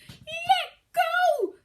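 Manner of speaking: high loud nasal cry; through the nose. Acted. Child caught by the nose crying out, nasal shout. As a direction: squeal it through a pinched nose. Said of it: "speaking through his nose".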